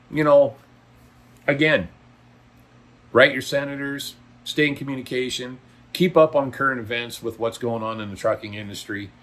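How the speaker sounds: usual speaking voice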